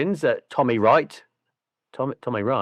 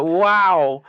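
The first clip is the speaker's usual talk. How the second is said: normal talking voice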